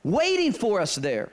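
high, ringing pulpit climax; preaching at full force. Acted. Pastor preaching to his church, full-force climax. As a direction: lift the congregation with a promise.

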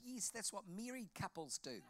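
coy imitation Kiwi accent. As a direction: mimic a young New Zealand woman. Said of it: "this girl had a Kiwi accent".

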